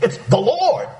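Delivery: usual speaking voice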